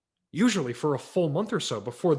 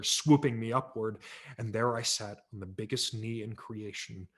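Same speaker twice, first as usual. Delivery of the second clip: ordinary delivery, nothing marked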